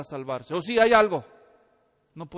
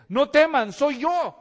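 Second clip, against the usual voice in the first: a raised call across the water; 6 semitones higher, 9 dB louder, slower than usual. Retold or imitated. Jesus calling to the disciples in the storm, loud. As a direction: call out loudly over the storm. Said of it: "Jesús les grita".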